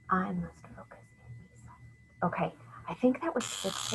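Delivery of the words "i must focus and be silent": very soft, near-whispered word count; hushed counting. Shown as a demonstration. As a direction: drop right down and tap out each word. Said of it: "I'm gonna do it again".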